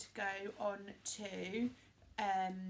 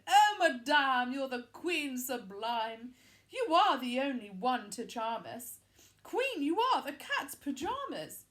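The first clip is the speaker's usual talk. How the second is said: flattering mirror; 7 dB louder than usual. A narrator's reading. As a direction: fawn over her like a magic mirror.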